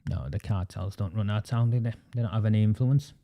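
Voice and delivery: ordinary talk, nothing marked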